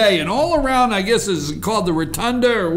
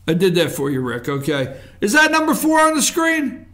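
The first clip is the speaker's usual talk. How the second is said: ordinary delivery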